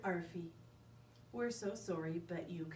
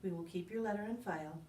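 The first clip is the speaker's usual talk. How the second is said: usual speaking voice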